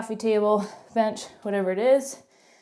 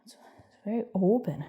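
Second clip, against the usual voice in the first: Canadian accent. Shown as a demonstration. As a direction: play up the Canadian accent on "open". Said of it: "there's my Canadian accent again".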